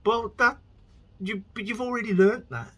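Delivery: high, loud exasperated yell; shouting at the screen. Retold or imitated. Viewer berates show characters for forgetting lessons, loud and high. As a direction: scold the characters through the TV.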